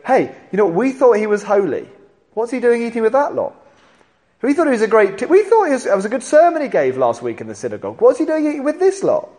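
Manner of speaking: huffy religious leaders, pitch climbing; scandalised Pharisees. Retold or imitated. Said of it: "they go".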